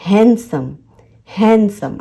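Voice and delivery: हैंडसम as "han-sum", no D; careful teacher's हैंडसम, D left out; silent D. Shown as a demonstration. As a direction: demonstrate हैंडसम with n running into s. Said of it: "आपको एन तक ही pronounce करना है".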